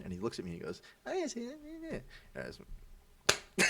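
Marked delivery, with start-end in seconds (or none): 1.0-2.0 tiny, very high soft voice; mock meek denial. Teammate confronted over teasing, imitated meek denial. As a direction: squeak it out as the cornered kid. Retelling scared kid's denial.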